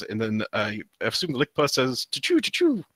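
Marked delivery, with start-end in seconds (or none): 2.1-2.8 much higher playful choo-choo. Acted. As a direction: chug out a toy train's choo-choo. Train sound read aloud.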